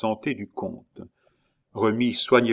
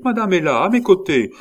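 high, insistent raised voice; loud calling back; 9 semitones higher, 8 dB louder than usual. A narrator's reading. Gertrude telling the count his wife is there, loud calling back. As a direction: call out to settle him.

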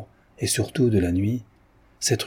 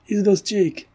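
high-pitched hail from some distance; calling out; 11 semitones higher than usual. A narrator's reading. A voice hailing the sleigh drivers from afar, calling out. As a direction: call out for a driver, pitched well up. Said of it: "cria une voix à quelque distance".

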